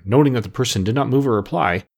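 plain reading voice

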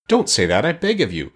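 mildly distressed plea, rising high; pleading cry. A narrator's reading. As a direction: beg with a touch of upset. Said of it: "cried the Tin Woodman".

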